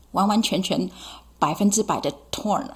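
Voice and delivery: ordinary delivery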